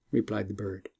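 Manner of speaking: ordinary delivery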